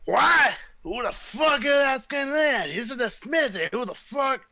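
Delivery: high, loud, cranky dwarf shouting from the back; grumpy dwarf yelling; gruff dwarf voice. Acted. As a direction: bark it out like an annoyed old smith. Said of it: "you hear a voice go".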